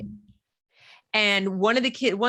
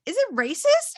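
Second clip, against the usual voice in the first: high-pitched, quick imitation of a child; pitched-up daughter voice; daughter explaining; 12 semitones higher, 3 dB louder than usual. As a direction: explain it in a kid's questioning voice. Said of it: "she's like".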